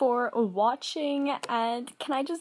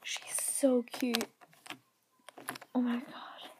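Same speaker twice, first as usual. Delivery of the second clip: slow awed whisper; 7 dB quieter, slower than usual. Real, not acted. Admiring the toy in a slow whisper. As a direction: breathe it out softly.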